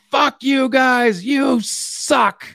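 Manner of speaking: high, slow mock insult; imitated Crashmore outburst. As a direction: draw out the abuse in a high voice. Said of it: "he's like".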